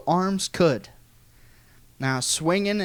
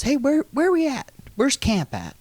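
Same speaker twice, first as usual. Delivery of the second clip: playful higher quizzing uncle; uncle's voice; 9 semitones higher than usual. Retold or imitated. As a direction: tease the kids like a sly uncle. Uncle quizzing kids in woods.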